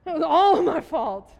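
high and loud, laughing at herself; loud rueful admission. Real, not acted. Preacher admits to the congregation the mistake was hers, loudly. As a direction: belt out the confession of her own fault.

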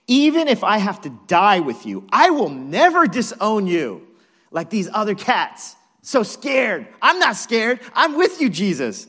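loud, high, swaggering vow; hammed-up Brooklyn bravado; emphatic insistence. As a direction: protest loyalty at full volume. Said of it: "Peter insisted emphatically".

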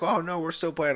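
whiny friends refusing to leave the table; friends protesting. Retold or imitated. Friends at the table protesting they're still playing, whiny. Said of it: "they're like".

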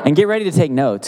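ordinary talk

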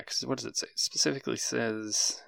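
very soft, half-whispered mumble; muttering to self. Real, not acted. Game master checking the rules to himself, muttering. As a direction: read under your breath.

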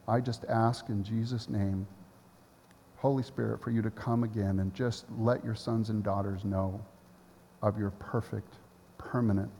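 soft, low prayer voice; closing prayer. Acted. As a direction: pray it softly over them.

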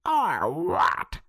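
high, loud snarling challenge; snarled. A narrator's reading. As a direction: sneer it back as a threat. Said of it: "snarled Leatherbag".

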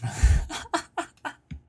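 breathless silent wheeze of laughter; wheezing laugh. Real, not acted. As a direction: crack up silently. Bursts out laughing at letter.

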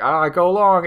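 hammed-up sporty call; playful Ganon taunt. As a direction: toss it off like a game of catch. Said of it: "and he's like".

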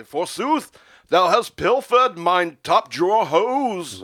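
high, slow, loud mock Olde English; old English Ben Franklin. Mimicking Ben Franklin's old English jab at the narrator, loud and hammed up. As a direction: ham up a pompous old English gent. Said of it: "in his old English way".

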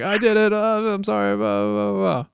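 high, loud blurted confession; mock confession. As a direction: crack and blurt it all out. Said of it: "And you're like".

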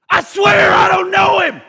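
loud, high, desperate oath; sworn denial. Acted. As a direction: swear it hard to get them off you. Peter swears the third denial.

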